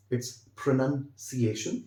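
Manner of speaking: pronunciation drawn out syllable by syllable; teacher's slow model of pronunciation; slow model word. Shown as a demonstration. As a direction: spell out pronunciation very slowly. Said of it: "इसे बोलते हैं pronunciation".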